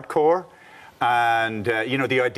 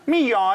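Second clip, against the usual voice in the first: higher, louder mock meow in a Northern Irish accent; Northern Irish cat; 10 semitones higher, 5 dB louder than usual. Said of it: "this is what a cat sounds like in Northern Ireland".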